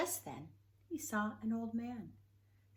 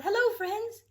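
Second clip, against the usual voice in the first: much higher and louder, a happy call across the way; 14 semitones higher, 12 dB louder than usual. Acted. Betty calling hello to her woodland friends, calling out. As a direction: call out to your friends from a distance. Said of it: "She called".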